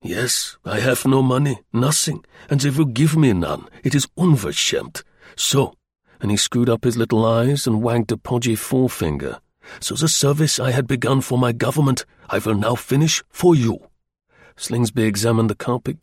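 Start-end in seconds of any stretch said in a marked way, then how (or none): 0.0-5.7 put-on indignant, German-accented complaint; aggrieved German agent. German agent pleading poverty to Slingsby, aggrieved and accented. 9.8-13.8 put-on German-accented, deliberate offer; resolved German agent. German agent offering his service to Slingsby, resolved and accented.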